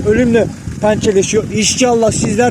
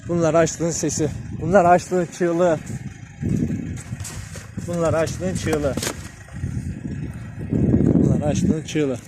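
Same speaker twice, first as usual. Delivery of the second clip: solemn lament; 4 semitones lower, 8 dB quieter, slower than usual. Real, not acted. Solemn lament over hungry dogs.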